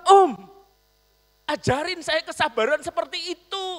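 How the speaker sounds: very high, loud eager begging; impatient pleading. Retold or imitated. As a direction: demand patience right now. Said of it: "mintanya cepet lagi". (memohon dengan tidak sabar, tinggi dan keras)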